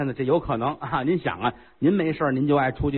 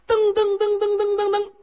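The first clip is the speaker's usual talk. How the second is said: vocal footsteps. Acted. Footsteps rushing to the hall.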